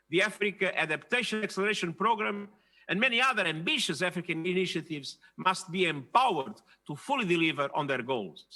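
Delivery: normal talking voice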